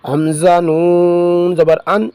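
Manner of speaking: slow, sing-song spelling chant; Qaida recitation drill. Shown as a demonstration. Teacher drilling pupils through Qaida spelling, slow sing-song chant. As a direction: call out each syllable slowly for pupils to repeat.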